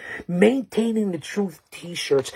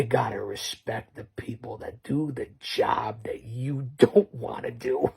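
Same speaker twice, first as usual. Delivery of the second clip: whisper; 4 semitones lower, 6 dB quieter than usual. Real, not acted. Hushed tribute to first responders.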